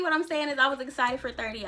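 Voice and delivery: ordinary delivery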